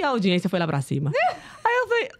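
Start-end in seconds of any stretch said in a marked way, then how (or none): 1.6-2.1 laughing. Real, not acted. Laughing while retelling.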